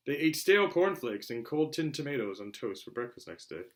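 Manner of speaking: usual speaking voice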